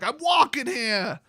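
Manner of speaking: put-on slow, high, drawn-out Brooklyn accent; hammed-up Brooklyn street protest. [name] bumped in the hallway, protesting in a hammed-up Brooklyn accent. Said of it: "Remake every movie with Brooklyn accents".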